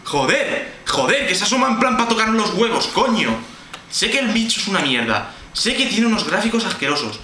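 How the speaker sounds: rattled, heated complaint; exasperated rant. Real, not acted. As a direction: vent your annoyance at the game. Rants after being scared.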